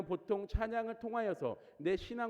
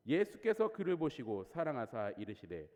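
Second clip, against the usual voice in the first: ordinary delivery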